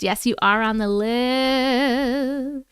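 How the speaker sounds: very slow, stretched-out confirmation; drawn-out reassurance. Real, not acted. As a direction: stretch it out, lingering on "list". Playfully reassuring a listed viewer.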